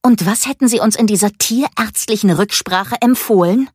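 put-on girl furious at the vet's advice; really angry. A narrator's reading. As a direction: throw the question back at him in anger. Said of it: "Nun wurde Mika richtig wütend".

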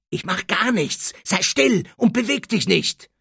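strained urgent voice; tense order. Acted. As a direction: hush him with tense urgency. Harry orders Dudley to be still.

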